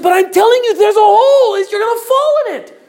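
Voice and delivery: high, loud, frantic shout; acted panicked yell; desperate warning. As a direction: shout at the top of your voice to stop her. Last warning before woman falls.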